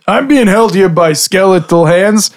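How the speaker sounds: ordinary talk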